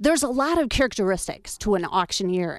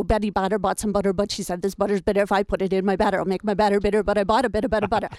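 fast tongue twister; faster than usual. Shown as a demonstration. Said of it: "Here's one I like to do".

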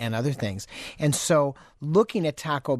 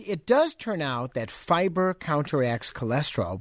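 usual speaking voice

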